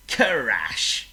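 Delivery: storyteller's big crash; dramatic crash. A narrator's reading. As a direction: land the crash with a bang.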